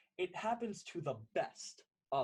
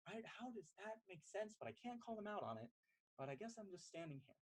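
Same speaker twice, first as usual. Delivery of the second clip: softer, quicker, under the breath; quiet inner voice; 4 semitones higher, 11 dB quieter than usual. Acted. Person B thinking privately while pretending to listen, hushed and quick. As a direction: mutter it to yourself, barely aloud.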